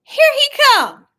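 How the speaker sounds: high, loud, excited announcement. Real, not acted. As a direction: build up the big moment. Dramatic moment in brother story.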